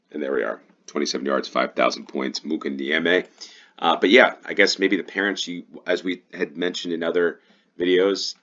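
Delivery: ordinary delivery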